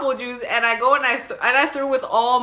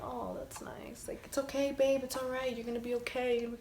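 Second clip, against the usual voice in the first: soothing voice; 3 semitones higher, 12 dB quieter than usual. Retold or imitated. Gentle partner soothing someone while rubbing their hair, tender. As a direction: coo it like calming someone. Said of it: "you're rubbing their hair and you're like".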